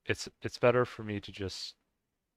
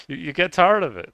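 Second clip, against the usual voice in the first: louder and higher, leaning on tired; emphatic louder repeat; 8 semitones higher, 11 dB louder than usual. Real, not acted. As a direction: push "tired" out loud and exasperated.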